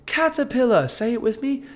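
put-on high, sing-song British kids' presenter; Steve and Maggie. Kids' video host teaching a word, high British accent. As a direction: imitate a British children's video host, bright high pitch. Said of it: "it was like a Steve and Maggie video on YouTube Kids".

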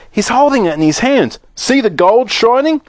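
high-pitched onlooker's cry; excited shout. A narrator's reading. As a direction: call it out to the crowd. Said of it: "someone else shouted".

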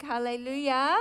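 normal talking voice

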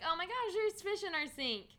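high, loud mock delight; mock surprise. Imagining the sophomores' reaction, mock surprise. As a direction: squeal it like a surprised freshman.

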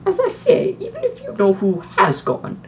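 sharp strict witch pushing on; McGonagall voice. A narrator's reading. Strict witch to the headmaster, pushing on sharply.

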